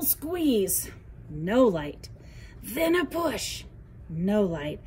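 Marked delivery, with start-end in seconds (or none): none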